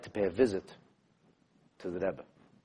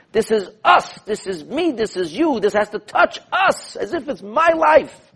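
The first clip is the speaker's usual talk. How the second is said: urgent plea; 11 semitones higher, 5 dB louder, slower than usual. Acted. Mordechai's urgent message to Hasach.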